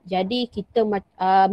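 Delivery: usual speaking voice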